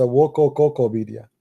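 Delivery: "Woko Cocopedia", Wikipedia garbled with repeated "ko" syllables; deliberate mispronunciation. Shown as a demonstration. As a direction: mangle it on purpose into "Woko Cocopedia", low. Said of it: "No, for me".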